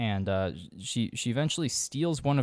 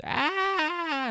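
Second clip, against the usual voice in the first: drawn-out, squealed "aah" imitating a victim; mock scream. Podcaster acting out the surrounded woman's scream, high mock shriek. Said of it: "and then she screams".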